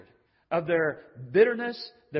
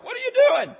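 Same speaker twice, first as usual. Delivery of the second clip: exasperated cry; 11 dB louder, much faster than usual. Retold or imitated. Jesus exasperated at servants.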